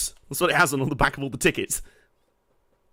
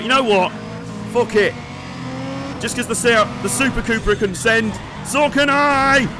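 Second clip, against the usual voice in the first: attempted Hindy accent; 6 semitones higher than usual. Player voicing his driver's bold decision to send it, attempted Hindy accent. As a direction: have a bad try at Hindy's accent, pitched up. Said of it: "Hindy's accent".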